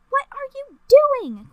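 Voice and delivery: high, loud and slow; alarmed cry. A narrator's reading. Queen calls to the girl who won't move, high alarmed cry. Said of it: "Queen Samantha cried".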